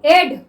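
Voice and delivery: teacher answering her own question; model pronunciation. Shown as a demonstration. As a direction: model एड clearly for students. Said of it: "क्या पढ़ते हैं हम इसे".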